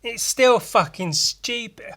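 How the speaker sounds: high, drawn-out mock disbelief; slow, exaggerated dawning disgust; exasperated realization. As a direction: stretch it out, high-pitched and incredulous. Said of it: "I thought back and I was like".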